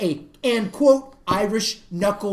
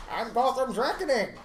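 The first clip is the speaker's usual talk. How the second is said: lightly voiced Bane, softer; Bane impression; 3 semitones higher, 4 dB quieter than usual. Fan quoting Bane to friends, lightly voiced. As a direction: slip into a muffled villain voice. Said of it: "hear him do that voice".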